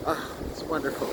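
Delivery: breathy whispered "Ah, it's wonderful"; whispered sigh. Real, not acted. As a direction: whisper it, awed by the storm. Repeat, whispered in the wind.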